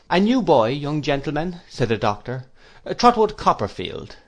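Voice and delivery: a hint of a gentle elderly scholar; mildly voiced absent-minded headmaster; lightly voiced kindly old doctor. Doctor Strong to Wickfield about a cousin, lightly voiced. Doctor introduces new boy.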